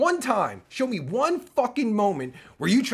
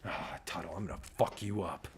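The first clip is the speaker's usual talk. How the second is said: very soft, low menacing threat; quiet, low threat through the teeth; menacing growl; 11 semitones lower, 13 dB quieter than usual. Acted. Threatening rival, voice dropped.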